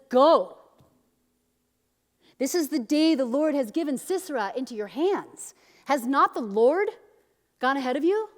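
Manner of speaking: loud, high-pitched scolding; scolding mom. Retold or imitated. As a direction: scold like a mother ordering her child out. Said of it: "scolds him like a mom".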